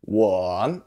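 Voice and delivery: "wo" dipping low, then rising; third tone. Shown as a demonstration. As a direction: dip deep on "wo" and draw it out. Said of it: "say with a third tone".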